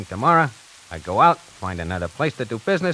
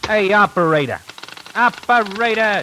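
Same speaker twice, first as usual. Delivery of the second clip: calling out; 8 semitones higher, 4 dB louder than usual. Acted. Impatient caller yells for operator.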